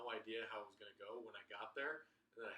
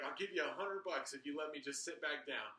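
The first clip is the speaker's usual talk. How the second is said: desperate bribe; 4 semitones higher, 6 dB louder than usual. Retold or imitated. Panicked bribe at door.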